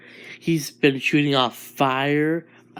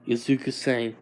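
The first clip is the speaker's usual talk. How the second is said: plain reading voice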